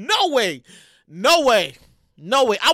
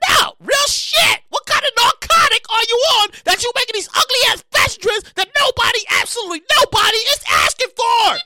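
high, loud ranting shout; 11 semitones higher, 6 dB louder than usual. Real, not acted. Ranting at fashion designers, shouting. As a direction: yell it at the designers. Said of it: "I start shouting".

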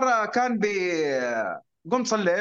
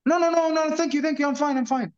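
polite refusal; 8 semitones higher, much slower than usual. Retold or imitated.

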